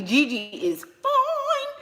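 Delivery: high, slow, singsong rave; gushing admiration. Real, not acted. Streamer tells viewers about Gigi's looks, gushing admiration.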